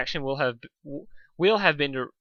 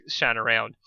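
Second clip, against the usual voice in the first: plain reading voice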